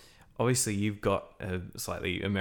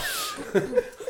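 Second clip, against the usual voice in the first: high, breathy burst of laughter; helpless high laugh. Real, not acted. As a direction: crack up at the bald joke. Group laughs at bald joke.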